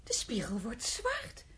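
slow hushed whisper; astonished whisper. Real, not acted. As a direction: whisper it as if seeing magic. Mirror trick surprises her.

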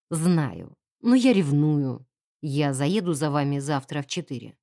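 low, measured male character voice. A narrator's reading. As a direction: drop low for the jealous suitor. Narrator voices Charles's jealous reply. (низкий голос ревнивого поклонника)

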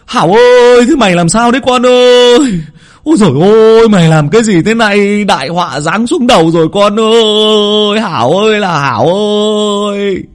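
high, loud despairing wail; wailing shout. Acted. Old father dropping to his knees, wailing at his son. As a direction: cry out to the son in anguish. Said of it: "Ông Hán quỳ sụp xuống mà hét lên".

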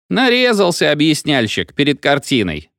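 plain reading voice

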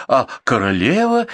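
suddenly remember and call out; lightly acted anxious cry; mild cry out. A narrator's reading. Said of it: "закричал он".

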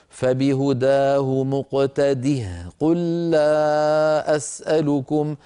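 slow melodic tajweed chant; Quran recitation. Acted. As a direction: recite the verse slowly and tunefully.

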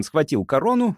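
ordinary delivery, nothing marked

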